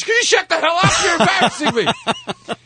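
father's scolding as a very high mock yelp; high exasperated scolding. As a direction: scold the son in a strained squeak. Finn scolding son, acted.

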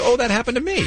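high, small squeaky voice; tiny little voice. Retold or imitated. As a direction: imitate a tiny timid voice. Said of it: "with her tiny little voice".

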